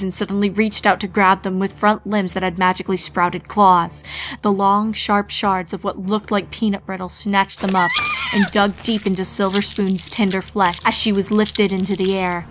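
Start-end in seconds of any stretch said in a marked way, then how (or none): none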